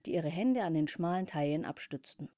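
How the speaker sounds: ordinary delivery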